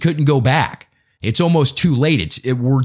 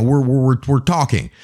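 usual speaking voice

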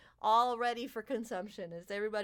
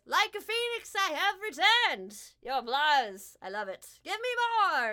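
higher, louder boastful cry; triumphant fey king; 7 semitones higher, 7 dB louder than usual. Acted. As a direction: revel in the crowd's applause. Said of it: "and he goes".

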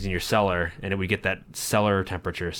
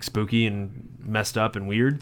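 ordinary talk, nothing marked

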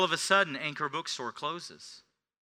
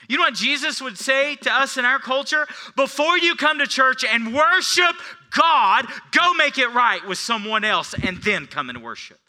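loud, high preacher's cry; pulpit shout; 11 semitones higher, 11 dB louder than usual. Acted. Pastor shouting Jesus' words to the church, loud and high.

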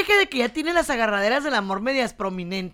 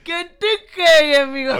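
teasing affection; 3 semitones higher, 7 dB louder than usual. Pretend. Teasing co-host after laughing.

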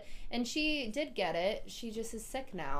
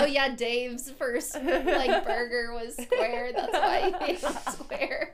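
mock slow explaining; 5 semitones higher, 7 dB louder, much slower than usual. Mocking Amber's slow explanation.

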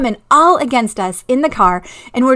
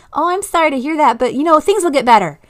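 higher, mock-sympathetic brush-off; breezy consoling; 6 semitones higher, faster than usual. Parent brushing off a child's bad news, breezy mock sympathy. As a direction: imitate a parent glibly cheering up a child. Acts out dismissive parent reply.

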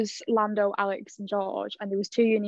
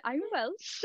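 put-on Welsh accent; 6 semitones higher, 6 dB quieter than usual. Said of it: "You went".

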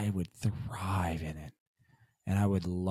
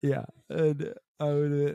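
ordinary talk

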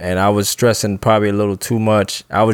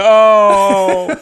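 loud high startled "Oh"; 8 semitones higher, 5 dB louder than usual. Retold or imitated. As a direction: caught off guard, gasp it out. Overhears don't give water.

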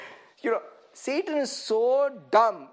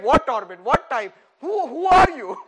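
louder baffled questions with a laugh; mock baffled stranger; 7 dB louder than usual. Preacher mocks a baffled stranger, laughing. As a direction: play a stranger confused by the accusation.

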